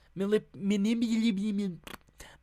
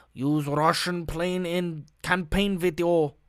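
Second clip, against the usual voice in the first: a slow, clumsy attempt at a Russian accent; failed Russian accent. Vlogger mocks a campaign headline in a botched Russian accent. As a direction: fumble a Russian accent on purpose. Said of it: "sounded nothing like a fucking Russian".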